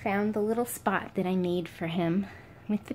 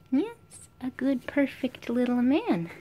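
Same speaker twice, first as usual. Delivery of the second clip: higher sing-song praise for the pet; high praise coo; 5 semitones higher, slower than usual. Real, not acted. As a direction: gush up high at the little guy.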